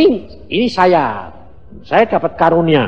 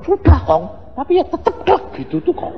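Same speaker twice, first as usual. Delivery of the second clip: granddaughter voice; 11 semitones higher than usual. Retold or imitated. Granddaughter quoted complaining.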